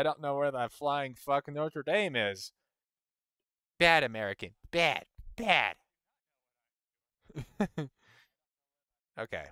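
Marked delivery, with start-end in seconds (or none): 3.8-5.8 loud, punched playful telling-off; mock scolding. Mock scolding of himself as American.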